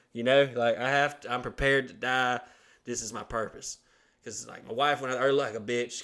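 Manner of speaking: ordinary delivery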